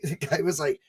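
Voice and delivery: ordinary talk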